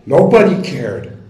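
loud, high, emphatic public tribute; impassioned declaration. Real, not acted. Resident praising a local hero at a public meeting, loudly impassioned. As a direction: proclaim it forcefully to the whole room.

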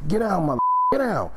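high, urgent holler imitating a robber; retold holler. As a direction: holler it as the gunman's order. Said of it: "He's hollering as he's telling me this".

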